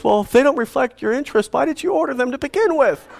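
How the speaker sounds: high, fast mock of a smug rep; lightly voiced, smug salesman logic; imitating the rep's circular reasoning, pitched high and quick. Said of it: "this guy with circular reasoning would say".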